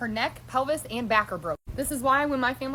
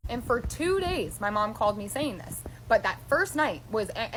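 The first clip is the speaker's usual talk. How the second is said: ordinary talk, nothing marked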